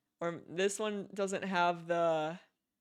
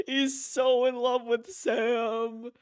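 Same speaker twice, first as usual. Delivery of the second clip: slow words through a laugh, higher; 4 semitones higher, slower than usual. Real, not acted. Player amused by Higgs's love for Sam, laughing. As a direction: giggle it out slowly.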